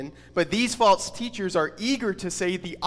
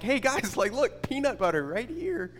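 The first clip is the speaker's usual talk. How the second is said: high-pitched playful excitement; eagerly calling mouse friends over, without shouting; lightly acted giddy little mouse; excited mouse; 6 semitones higher than usual. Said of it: "like, really excited".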